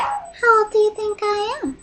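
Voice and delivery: very high, soft mock-girlish question; tiny high-pitched teasing voice; coy high voice. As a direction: squeak it softly, playing coy. Second take, coy retold question.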